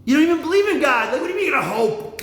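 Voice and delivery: high, forceful mock tirade; exasperated berating. Son challenging dad's hoping, acted exasperated outburst. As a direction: berate him in high-pitched disbelief.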